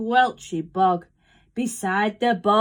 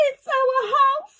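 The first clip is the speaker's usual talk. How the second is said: very high mother-mouse voice; squeaking mouse; 4 dB louder than usual. A narrator's reading. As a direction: squeak it out in distress. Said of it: "squeaked the mother mouse".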